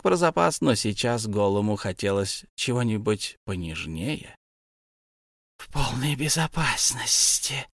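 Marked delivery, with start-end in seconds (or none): 5.6-7.7 slow, hissing creature mutter; Gollum whispering to himself. A narrator's reading. Gollum mutters to himself, slow and creepy. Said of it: "шептал он себе под нос".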